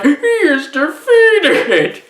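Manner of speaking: high, drawn-out mock battle cry; hammed-up overdubbed yell, loud and stretched; bad movie ADR. Reviewer mocking a movie's dubbed line, hammed-up high yell. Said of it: "really funny bad A D R".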